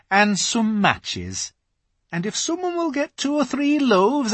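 high, busy beaver-wife voice; a practical housewife giving orders; brisk Missus Beaver; bustling Missus Beaver. A narrator's reading.

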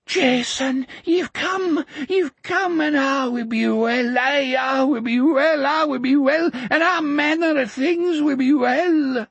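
put-on high, quavering old man in joyful tears; weeping old man. A narrator's reading. Old depot keeper kneeling before Jack, weeping with joy. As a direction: babble it out in tearful awe.

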